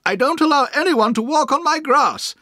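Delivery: put-on high, stern old aunt scolding; cross aunt. A narrator's reading. Aunt scolds the boy off her lawn, sternly. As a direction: lay down the rule about her grass. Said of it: "she said crossly".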